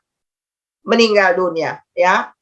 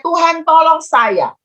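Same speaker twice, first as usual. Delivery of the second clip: retold cry for help; 6 semitones higher, slower than usual. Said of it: "tiba-tiba dia berteriak".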